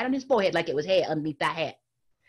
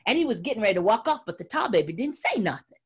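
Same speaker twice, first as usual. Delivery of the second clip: ordinary delivery, nothing marked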